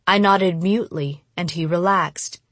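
ordinary delivery, nothing marked

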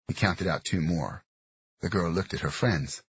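usual speaking voice

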